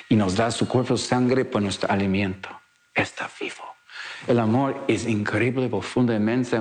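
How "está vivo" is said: awed whisper. Real, not acted.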